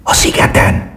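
voiceless hushed whisper. A narrator's reading. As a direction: breathe it out in a whisper. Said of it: "suttogta Kandurka".

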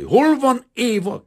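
slow, high, desperate shout; rasping demand. A narrator's reading. Painter confronting the frightened maid, desperately rasping. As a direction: roar it out, straining from the throat. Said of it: "kérdezte tőle hörögve".

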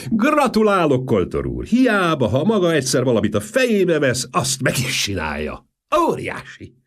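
loud, high, hearty congratulation; booming praise; shouting. A narrator's reading. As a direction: clap him on the back with your voice. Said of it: "ordította".

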